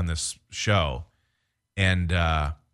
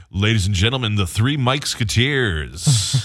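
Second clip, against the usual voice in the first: club announcer; 5 dB louder than usual. Retold or imitated. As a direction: stretch it out like a club MC. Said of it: "they would always go".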